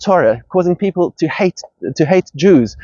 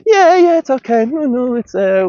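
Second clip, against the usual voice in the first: higher, breezy shrug of a voice; mock ambivalence; 9 semitones higher than usual. Mocking the moderate who shrugs everything off. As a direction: brush it off like it doesn't matter. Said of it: "an ambivalence".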